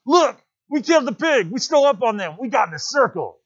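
very high, loud triumphant boast; excited boasting. Acted. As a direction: crow to Ralph about the kill. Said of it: "hailed Ralph excitedly".

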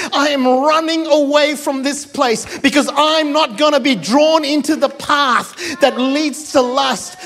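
high, emphatic, driving refusal; defiant Joseph. Acted. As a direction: declare it urgently, as a man running from sin.

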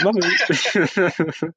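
high, startled little Mummy; a child calling for mum by accident; blurting out Mummy. Retold or imitated. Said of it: "I was just like".

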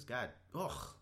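ordinary talk

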